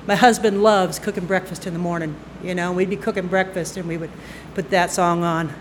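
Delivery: plain reading voice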